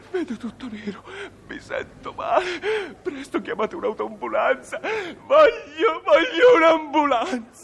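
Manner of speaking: slow, wailing mock collapse, calling for help; hammed-up fainting. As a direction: ham up a melodramatic swoon. Passenger fakes collapse.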